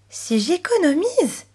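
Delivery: put-on high, slow, puzzled little girl; astonished question. A narrator's reading. Chloé answering the pig about saving, astonished. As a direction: question it slowly, pitch climbing in surprise. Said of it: "s'étonna Chloé".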